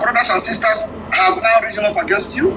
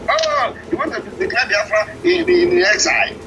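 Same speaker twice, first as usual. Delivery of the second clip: mocking quote; 7 semitones higher than usual. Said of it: "many of them are still laughing".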